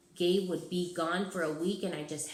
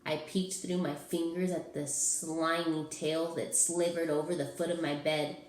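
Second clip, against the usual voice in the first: ordinary delivery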